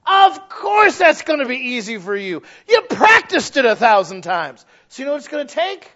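loud, high, fervent preaching; preaching at full force. Acted. Preacher pounding the pulpit about practiced sin. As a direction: hammer it home to the congregation.

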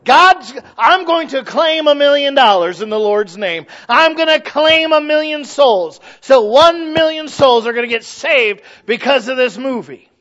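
high, booming mock proclamation; mock televangelist claiming. Preacher imitating a televangelist's name-it-and-claim-it, loud and high. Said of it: "That's what he believes".

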